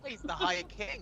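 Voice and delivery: high, loud royal proclamation; proclaiming. Acted. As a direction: announce it grandly. Mock-solemn proclamation.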